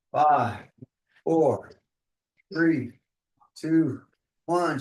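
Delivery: loud, punched countdown. Real, not acted. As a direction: bark each number to the gym. Coach calling countdown to fighter.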